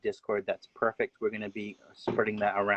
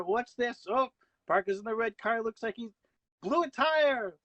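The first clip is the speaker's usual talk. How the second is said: much higher, rising commentator excitement; excited race call; 8 semitones higher than usual. Pretend. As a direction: call the drama of a blown tire. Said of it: "how about you call the final half a lap of a race?".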